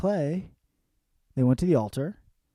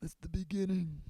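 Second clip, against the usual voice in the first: soft mock British delivery, lightly done; a hint of a hushed actress; imitating Carey Mulligan; 7 semitones higher, 10 dB quieter than usual. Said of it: "Carey Mulligan's using a real voice".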